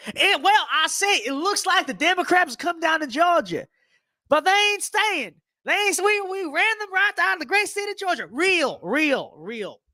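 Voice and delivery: very high-pitched Southern caricature; put-on Southern accent. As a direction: crow like a Southern good ol' boy, voice way up. Acted Georgian boasting about Democrats.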